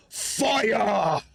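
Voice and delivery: high, drawn-out yell; yelled command. Acted. Hobgoblin orders a fireball down the hall, yelled.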